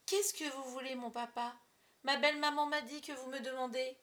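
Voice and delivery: theatrical dutiful daughter; little girl on stage. Acted. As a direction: project innocently to the back of the hall. Child performing her stage role.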